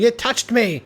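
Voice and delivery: mock cry of alarm; retold yell. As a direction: act out a panicked yell without full volume. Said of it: "running out of the bedroom yelling".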